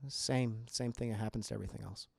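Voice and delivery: imitating a flat, bored line reading; mocking monotone. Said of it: "she says it's so monotone".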